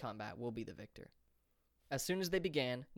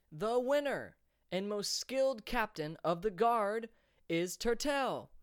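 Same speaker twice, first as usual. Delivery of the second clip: high, loud, slow victory announcement; announcing the winner; 9 semitones higher, 5 dB louder, slower than usual. A narrator's reading. Announcer crowning Turtel before the crowd, slow and booming. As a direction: stretch out the champion's name.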